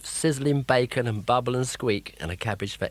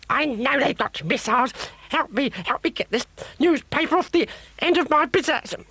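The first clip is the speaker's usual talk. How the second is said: very high, shrill frantic witch; a witch scrambling in a panic; 4 dB louder than usual. A narrator's reading. Witch panics under attack.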